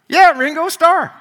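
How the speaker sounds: high, loud proud insistence; imitating the man. Pastor retelling the man at Winco, high proud insistence. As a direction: mimic a proud stranger announcing himself.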